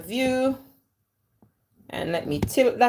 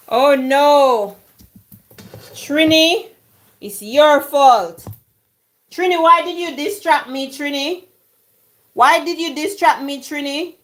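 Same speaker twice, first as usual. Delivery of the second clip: higher-pitched, playful accusing wail; mock dismay; 4 semitones higher than usual. Host blaming Trini for distracting her, mock dismay. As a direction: protest to the culprit in exaggerated dismay. Mock-blames viewer for cooking mishap.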